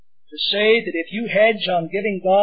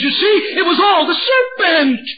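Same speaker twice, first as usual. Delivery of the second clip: higher and louder, scoffing at the excuse; mocking exclamation; 10 semitones higher, 6 dB louder than usual. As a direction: throw it out with scorn. Preacher mocks blame-shifting.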